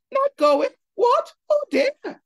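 very high, loud put-on English accent; fake foreign twang. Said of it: "his foreign twang the boy was a put on".

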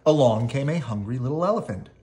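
plain reading voice

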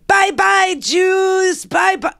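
put-on very high, sing-song child voice; chirpy little girl calling bye-bye; little girl's baby voice. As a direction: wave goodbye like a tiny child. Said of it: "in this little baby voice".

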